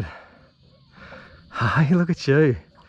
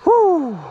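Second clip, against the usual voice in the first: whew; 4 dB louder than usual. Real, not acted. Relief after landing fish.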